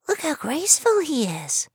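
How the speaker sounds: little mouse admires the creature; admiring mouse. Acted. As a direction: sigh it out in wonder as a tiny mouse. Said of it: "Jane said admiringly".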